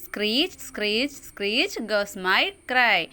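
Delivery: put-on slow sing-song recital of a children's rhyme; poem recitation. Shown as a demonstration. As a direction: recite it slowly like a nursery rhyme. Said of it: "ചൊല്ലി നോക്കാം". (പതിയെ ഈണത്തിൽ കവിത ചൊല്ലൽ)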